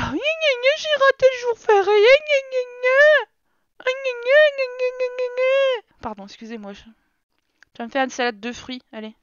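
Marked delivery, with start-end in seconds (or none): 0.0-6.0 high-pitched "gna gna" whining; exaggerated, squeaky mock complaint; mocking whine. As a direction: mimic a whiner in a silly high voice.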